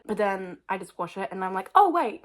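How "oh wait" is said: surprised. Retold or imitated. Sudden change of mind about hair.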